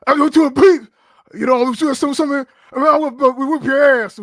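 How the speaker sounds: loud, high-pitched garbled wrestler impression; Ahmed Johnson. Guest mimics wrestler he supports for podcast host, wrestler impression. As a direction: blurt it out like an incoherent wrestling promo. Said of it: "That's how he sounds, right? Allegedly".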